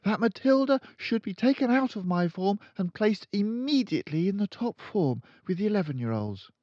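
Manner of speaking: put-on high, polite young teacher; high pleading teacher; timid teacher. A narrator's reading. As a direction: stand firm though nervous. Miss Honey proposes.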